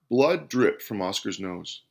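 ordinary delivery

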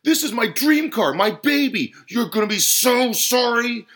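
high, loud, sustained rant; furious, threatening tirade; spitting anger. A narrator's reading. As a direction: rage on at full volume. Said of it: "spat the customer".

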